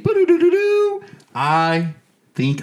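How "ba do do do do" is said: high, loud announcement fanfare; mock jingle. Host announcing a feet update, loud high fanfare sound. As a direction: belt out a spoken-sung update jingle.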